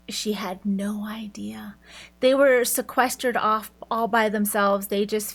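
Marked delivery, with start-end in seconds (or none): 0.1-1.7 hushed whisper, like sharing a secret; stage whisper. Real, not acted. Telling viewers Dala never knew, in a slow whisper. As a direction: drop to a slow whisper for effect. Dramatic aside about Dala's ignorance.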